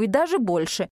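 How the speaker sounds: plain reading voice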